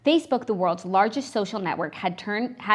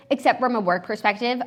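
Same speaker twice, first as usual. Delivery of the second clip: usual speaking voice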